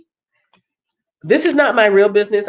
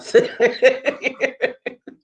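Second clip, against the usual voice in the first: higher-pitched bubbling laugh; chuckle; 5 semitones higher than usual. Real, not acted. Host amused by own congestion, giggling. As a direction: let out a run of quick giggles. Laughs after joking about snorting.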